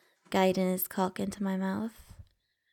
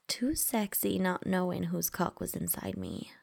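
usual speaking voice

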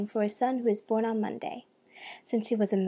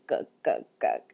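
deep throaty chuckle; low chuckle. Acted. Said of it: "Ananse chuckled".

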